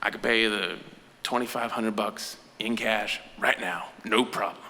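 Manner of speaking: low conspiratorial dealer. Retold or imitated. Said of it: "and goes".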